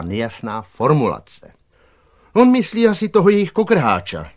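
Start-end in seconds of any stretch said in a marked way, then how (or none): 2.3-4.3 put-on high, loud boy character voice; boy's voice. A narrator's reading. Boy chief explains the letter to his gang, high-voiced. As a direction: pitch it well up and explain eagerly.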